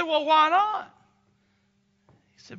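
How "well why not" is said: loud, high, pressing question; puzzled husband. Retold or imitated. Captain pressing his wife, loud and high. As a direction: demand an answer, half laughing.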